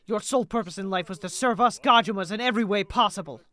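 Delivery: forceful, declamatory contempt; rally your side and scorn the enemy; theatrical anger with punched words; haughty supremacist. Acted. Voices feuding supremacists in game.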